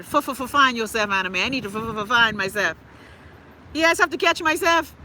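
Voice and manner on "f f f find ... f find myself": drawn-out stutter on the F; Lawrence Fishburne. Acted. Quoting an actor's line to herself, exaggerated stuttered impression. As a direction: stutter the start of "find" like a film quote. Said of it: "like Lawrence Fishburne said".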